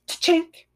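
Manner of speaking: high clicky shutter sound effect; camera shutter noise. Shown as a demonstration. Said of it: "Like the shutter".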